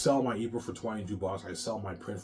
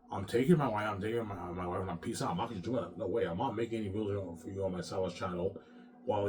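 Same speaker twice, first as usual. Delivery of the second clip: ordinary talk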